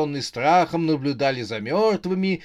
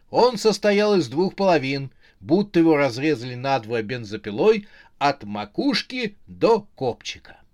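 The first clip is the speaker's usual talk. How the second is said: ordinary delivery, nothing marked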